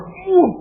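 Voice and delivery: acted high-pitched "uh" of reaction; loud high "uh". Reacting to praise of his English, loud high "uh".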